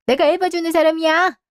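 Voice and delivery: angry mother snapping at her son; indignant outburst. Acted. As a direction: throw it back at him, offended. Mother angered by son's remark.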